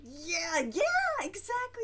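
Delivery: high, loud imitation of an eager fan; hammed-up excited agreement; mock excited cheer. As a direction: cheer it on like a thrilled viewer. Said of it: "you get so excited".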